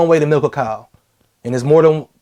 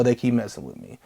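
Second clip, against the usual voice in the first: much softer, discreet complaint; low-key; 6 semitones lower, 10 dB quieter than usual. Retold or imitated. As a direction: keep it quiet on the side. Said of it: "on a low-key side".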